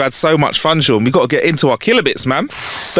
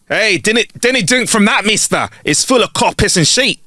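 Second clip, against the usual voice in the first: higher-pitched, hammed-up Scottish shout-out; bad Scottish accent; 8 semitones higher, slower than usual. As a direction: call out in a badly faked Scottish accent. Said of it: "your accent was so shit".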